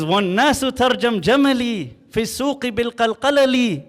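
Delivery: fast, loud, rising chant of Arabic verse; rapid poem recitation. Acted. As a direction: rattle off the poem like a showman poet, drums and all.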